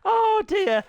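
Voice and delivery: high, loud yelp of dismay; high-pitched exasperated cry; squeaky dismay. Real, not acted. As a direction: squeal it in alarm. Dismay at glitching game.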